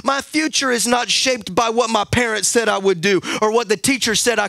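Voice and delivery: loud, high preacher's cadence over music; preaching at full force. Acted. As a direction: preach it out, rising over the band.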